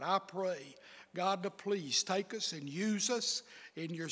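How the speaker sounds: soft, earnest prayer voice; hushed pulpit prayer. Acted. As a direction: pray it quietly to God. Leads an opening prayer.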